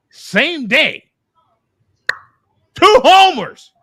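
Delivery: very high, loud punchline; amazed exclamation. Real, not acted. Fan reaching the climax of the doubleheader, amazed exclamation. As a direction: hit each short phrase loud and high. Climax of amazing baseball story.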